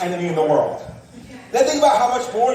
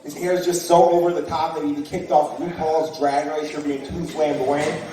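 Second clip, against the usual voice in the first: normal talking voice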